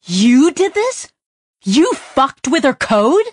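stunned accusation, loud and slow; shocked disbelief. Acted. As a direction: spell out each word in outrage. Pepper shocked at Sidra.

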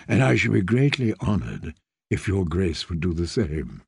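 put-on a gentle young lord bowing to a girl; low courtly knight. A narrator's reading. Knight greets the child queen, low and courtly.

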